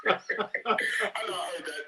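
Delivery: high, loud, slow laughing admission. Real, not acted. Admitting he was triggered, laughing hard. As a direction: laugh it out, caught out. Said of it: "I was triggered".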